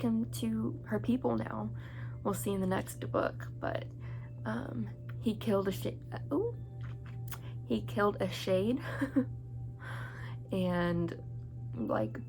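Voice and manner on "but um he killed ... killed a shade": ordinary talk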